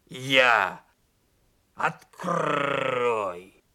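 drawn-out growly demand with rolled r; hoarse wolfish voice. A narrator's reading. Donkey demanding bear cub open up, growly. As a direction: growl it like a donkey turning wolf. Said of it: "прохрипел ослик".

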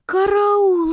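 high, loud cry for help; alarmed cry. A narrator's reading. Teacher discovering the compote gone, loud alarmed cry. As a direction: shriek "Караул!" in alarm. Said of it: "закричала воспитательница".

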